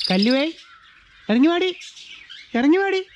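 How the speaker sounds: high calling voice to a pet up a tree; calling the bird down from above. Real, not acted. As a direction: call her down, pitch high.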